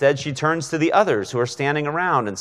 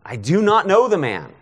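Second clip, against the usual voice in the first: raised, high-pitched oath of denial; insistent, higher-pitched swearing; emphatic sworn denial; 8 semitones higher, 4 dB louder than usual. Acted. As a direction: swear it forcefully, pitch leaping up. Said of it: "begins to invoke a curse on himself and to swear".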